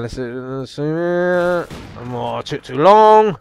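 ordinary delivery

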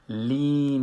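"لین" with a long held "ee"; smiling kasra. Shown as a demonstration. Teacher shows kasra in the Fatiha, "لین" held long. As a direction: draw out "لین" through spread lips.

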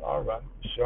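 very soft, low dismissal; retold quiet brush-off. To himself, not explaining himself, quiet low brush-off. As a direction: let it go under your breath. His unspoken resigned answer, retold.

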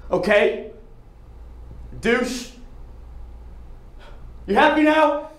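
bitter, loud and high; angry outburst. Acted. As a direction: snap at them bitterly. Monologue ends in angry outburst.

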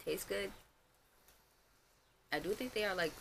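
talking while chewing; speaking between bites; mouth full of cookie. Real, not acted. Seen 1: cookie held at lips, bites between words, mouth likely full.

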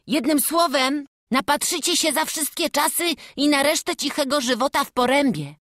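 bright eager lad speaking to the family; character voice. Acted. Young church helper inviting the family in, eager.

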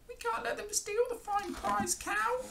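very high, squeaky cat voice; high-pitched feline wail; meowing cats. A narrator's reading. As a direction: mew the words like a cat. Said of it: "both the cats began to meow".

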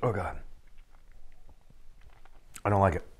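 talking while chewing food; mouth full, chewing. Real, not acted. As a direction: hand over mouth, muffled. Seen 1: Grimaces and chews after a forkful, hand at mouth until 2.1s.

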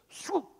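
quick high whooshing noise; slingshot swoosh. Acted. Storyteller mimics the boy firing his slingshot, swooshing. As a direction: imitate a rock flying off.

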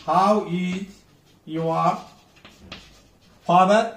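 How is, pause, your, pause, father rising; piece-by-piece demo with father rising. Shown as a demonstration. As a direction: break up How is your father, lifting the end. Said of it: "we should raise our tone".

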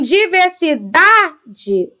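diversidade said slowly with DA stretched; stress on DA. Shown as a demonstration. Teacher locating the penultimate syllable, diversidade stretched on DA. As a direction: hold and punch the DA in diversidade. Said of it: "a letra dá com mais força".

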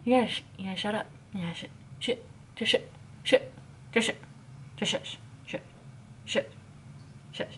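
sputters down to a whispered shh; fading shush. Pretend. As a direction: trail off into a hissed shush. Shushing the imagined Emma.

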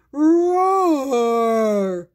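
loud booming monster roar; sea monster roar. Acted. Sea monster roars louder.

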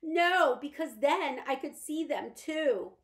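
terrified of what the light reveals; panicked mother. Acted. Mother panics about the curtains.

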